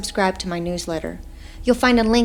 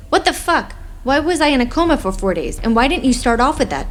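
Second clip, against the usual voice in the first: higher and louder, indignant demand; outraged disbelief; 6 semitones higher, 5 dB louder than usual. Acted. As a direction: make the doctor answer for it. Angry patient confronting doctor.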